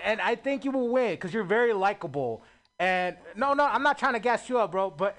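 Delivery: normal talking voice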